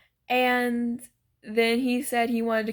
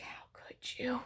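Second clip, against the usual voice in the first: slow, wounded whisper; hushed mock hurt; whispered mock betrayal; 13 dB quieter, slower than usual. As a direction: breathe it out as if deeply betrayed, playfully. Mock betrayal over accidental spoiler.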